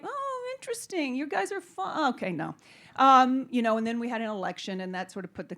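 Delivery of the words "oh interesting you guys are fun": high, soft, falsely polite brush-off; mock network exec. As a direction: play a network executive faking interest. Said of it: "the networks were like".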